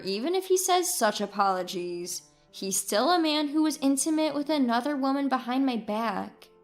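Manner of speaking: ordinary delivery, nothing marked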